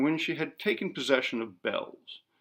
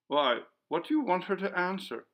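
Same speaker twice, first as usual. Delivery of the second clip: impatient; 7 semitones higher than usual. A narrator's reading. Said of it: "uttered an impatient exclamation".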